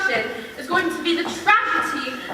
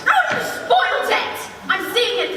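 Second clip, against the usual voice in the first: very high theatrical wail of protest; dismayed squeal; 9 semitones higher than usual. Acted. Patron just told the play's ending, squealing in dismay. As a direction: squeal in comic outrage, voice leaping up.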